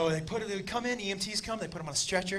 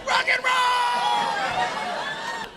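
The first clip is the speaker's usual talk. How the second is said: very high, long-drawn holler; rock-show yell; 12 semitones higher, much slower than usual. Acted. Comic acting out wild concert moment, drawn-out high yell. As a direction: stretch it out like a crowd-rousing scream. Sudden acted festival yell.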